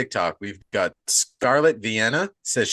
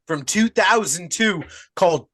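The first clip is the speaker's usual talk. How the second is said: put-on botched Australian accent; 4 semitones higher than usual. Host welcoming listeners to Hackstab Slash, botched Australian accent. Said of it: "This sounds more Australian than fucking anything".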